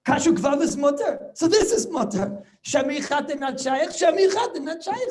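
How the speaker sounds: high, loud, breezy mock ruling; mock obvious ruling. Rebbe voicing how they should have ruled, high and loud. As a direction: toss it off as totally obvious. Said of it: "Why don't they just say".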